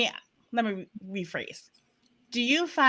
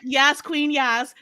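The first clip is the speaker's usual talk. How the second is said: ordinary delivery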